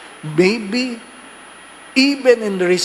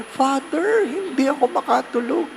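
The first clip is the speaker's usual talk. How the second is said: a higher, plaintive complaint; lightly voiced worried parishioner; 9 semitones higher than usual. Parishioner telling the priest she can't sleep, mock plaintive.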